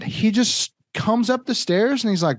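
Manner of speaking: usual speaking voice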